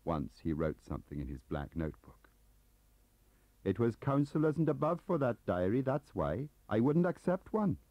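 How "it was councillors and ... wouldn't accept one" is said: higher, louder Welsh gossip; chatty, eager Welsh clerk. Acted. Gaunt proud refusal.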